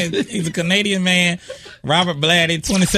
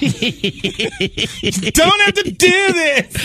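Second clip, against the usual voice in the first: very slow, high mock wail; desperate plea; 11 semitones higher, much slower than usual. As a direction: stretch it out, begging for mercy. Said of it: "he was like".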